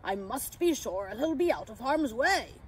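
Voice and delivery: concerned character voice; Santa. A narrator's reading. Santa wanting the pixie out of harm's way, concerned. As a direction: resolve it with fatherly worry. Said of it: "I heard Santa say".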